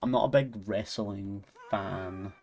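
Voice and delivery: ordinary delivery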